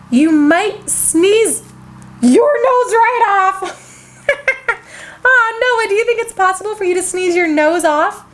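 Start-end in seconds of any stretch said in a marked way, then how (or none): 0.1-4.3 warning. Acted. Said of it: "Minnie warned".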